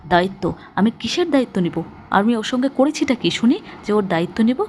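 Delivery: plain reading voice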